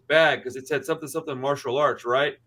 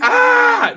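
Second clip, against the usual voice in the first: loud, very high imitated scream; sudden hammed-up shriek; 11 dB louder than usual. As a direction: scream like the startled passenger. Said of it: "this lady just starts screaming".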